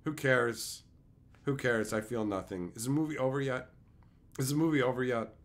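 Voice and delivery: low, sinking, drained delivery; bored indifference. Retold or imitated. Viewer stuck in a dull movie, bored and deflated. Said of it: "it was just like".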